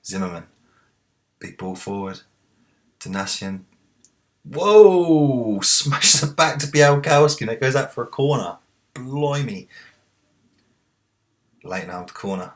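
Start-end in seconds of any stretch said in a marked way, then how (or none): 4.4-9.7 high loud commentator yell; excited shout. Real, not acted. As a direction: shout the save as it happens. Shot saved live.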